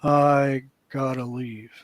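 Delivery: slow, low, flat-out daze; lightly voiced zombie-like inmate; dazed inmate voice. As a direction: drag the words like a zombie. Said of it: "and he goes".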